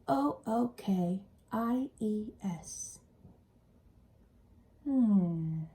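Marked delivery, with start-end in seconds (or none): none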